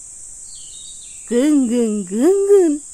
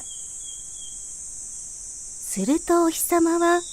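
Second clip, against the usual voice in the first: usual speaking voice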